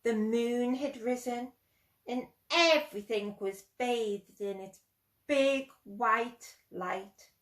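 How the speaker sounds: ordinary delivery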